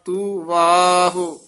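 slow, measured chant of scripture; recited gurbani line. Shown as a demonstration. Teacher reciting scripture for students, measured chant.

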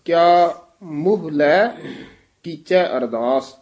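ਮੋਹ clipped to muh within the line; short quick vowel. Shown as a demonstration. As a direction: say ਮੋਹ briefly as muh, pause after ਲੈ. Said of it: "ਮੋਹ ਛੇਤੀ ਬੋਲਣਾ".

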